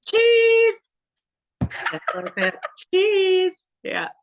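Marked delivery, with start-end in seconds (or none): 0.0-0.9 squealed, much higher and louder cheesy sign-off. Pretend. Pageant girl hamming up her sign-off with a high squeal. 2.9-3.6 squealed, much higher and louder cheesy sign-off. Pretend. Pageant girl hamming up her sign-off with a high squeal.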